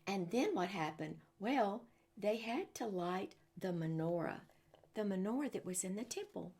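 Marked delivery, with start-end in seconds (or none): none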